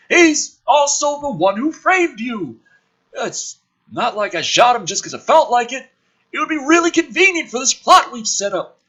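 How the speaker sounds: high, loud brash military man; gruff officer voice. Acted. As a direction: play the cocky colonel loud and high. Woodhurst voiced, mocking the plot.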